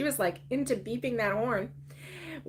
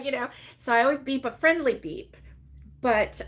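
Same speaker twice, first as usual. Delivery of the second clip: ordinary talk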